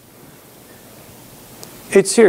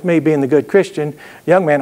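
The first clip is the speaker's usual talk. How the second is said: ordinary delivery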